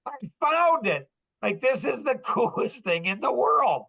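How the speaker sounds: high, giddy eureka; excited discovery. Retold or imitated. Waking up with the new model, high excited eureka. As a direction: burst out like you just struck gold. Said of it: "I'm so excited".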